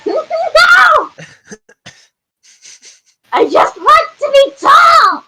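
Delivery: very high, hammed-up childish wail; wailing protest. Rider being put down by friend, wailing protest. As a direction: shout it like a thwarted little kid.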